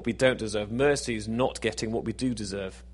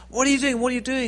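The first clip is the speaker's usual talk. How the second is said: alarmed woman; 12 semitones higher, 5 dB louder, faster than usual. Acted. Woman runs to man on parapet.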